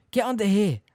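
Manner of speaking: urgent. Acted. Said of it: "Harry said quickly".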